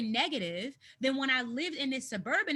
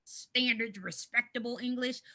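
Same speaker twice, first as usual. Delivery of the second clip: prim proper-English voice; mock proper voice; 4 dB louder, slower than usual. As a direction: say proper like a snob. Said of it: "quote unquote proper".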